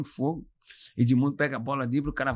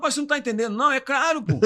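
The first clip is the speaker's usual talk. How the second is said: high, loud exasperated protest; frustrated protest; 11 semitones higher, 5 dB louder than usual. Retold or imitated. The player argues back at the director, loud and exasperated. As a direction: insist you did say it right. Arguing with the director over diction.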